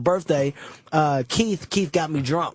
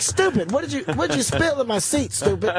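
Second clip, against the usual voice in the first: angry Keith impression; 7 semitones higher than usual.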